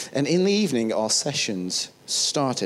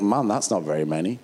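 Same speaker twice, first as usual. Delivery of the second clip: lower, deflated private aside; inner doubt; 6 semitones lower than usual. Retold or imitated. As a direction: let the pitch sink in mild disappointment. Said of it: "And inside I went".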